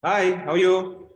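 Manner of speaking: high, loud, drawn-out hello to a little one. Real, not acted. Teacher greets a student's small son, child-directed greeting.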